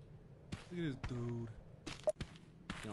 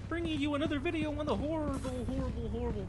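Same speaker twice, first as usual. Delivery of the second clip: high, loud youtuber intro voice; hammy host intro; 9 semitones higher, 4 dB louder than usual. Pretend. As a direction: announce it like a hyped host. Mock video intro voice.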